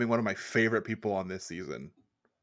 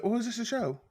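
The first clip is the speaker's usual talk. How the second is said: curious onlookers; 9 semitones higher than usual. Retold or imitated. Mocking bystanders at Whole Foods.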